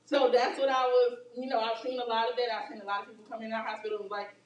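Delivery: usual speaking voice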